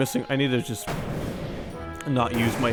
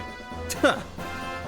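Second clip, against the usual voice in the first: breathless whispered villain laugh; airy evil snicker, high; 10 semitones higher, 5 dB quieter than usual. Acted. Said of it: "I should just go full liquid for this guy".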